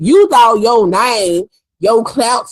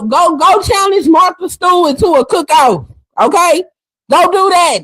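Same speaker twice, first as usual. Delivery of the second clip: high, raised taunt; mocking shout; 6 semitones higher than usual. Real, not acted. Vlogger taunting Layla with a raised shout. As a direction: send her off mockingly.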